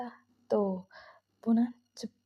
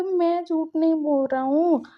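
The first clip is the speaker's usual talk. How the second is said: retold protest, higher and louder; defensive protest; 5 semitones higher, 5 dB louder than usual. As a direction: insist hotly that you are not lying. Girl defends herself.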